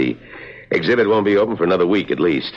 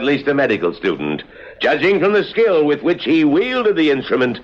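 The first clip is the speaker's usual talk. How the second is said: a showman's raised, sing-song guide patter; museum tour spiel; 9 semitones higher than usual. Acted. Tour guide wraps up the murder museum tour for visitors, theatrical patter. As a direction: present each exhibit like a carnival barker.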